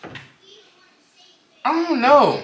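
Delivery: chewing gum while talking; mouth full. Real, not acted. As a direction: talk around a wad of gum. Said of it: "I got gum in my mouth".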